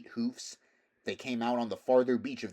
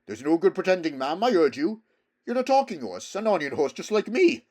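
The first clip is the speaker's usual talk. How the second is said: loud, higher, pressing horse voice; insistent horse; 8 semitones higher, 11 dB louder than usual. Acted. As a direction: press the mare to admit it. Bree confronting the mare.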